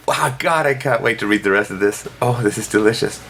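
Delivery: mock-delighted; sarcastic glee. Real, not acted. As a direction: savour it with sarcasm.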